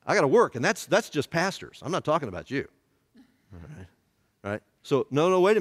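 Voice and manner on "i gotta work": loud, high mock excuse; mock busy pastor. Pastor making excuses to skip worship, hammed-up and loud. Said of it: "We were like".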